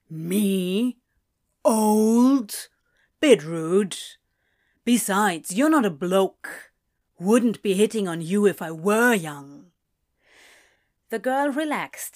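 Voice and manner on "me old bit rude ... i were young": loud, hammed-up indignation; theatrical offense. A narrator's reading. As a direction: act wounded, punching the short words. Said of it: "gasped in theatrical offense".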